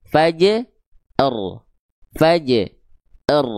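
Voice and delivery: "Fa, j" joined, then a pause before "r"; sambung sebagian. Shown as a demonstration. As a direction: link "Fa, j" and set "r" apart. ("Fa, j" disambung, lalu jeda sebelum "r")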